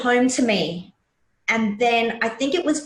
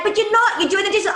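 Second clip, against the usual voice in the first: put-on high, louder kids' protest; kids protesting; 12 semitones higher, 3 dB louder, faster than usual. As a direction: mimic the kids calling her out, high. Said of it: "They're like".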